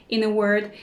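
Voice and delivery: ordinary delivery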